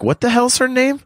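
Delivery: high-pitched retold bafflement; baffled reaction. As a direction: react baffled to a name, voice jumping up. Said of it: "I was like".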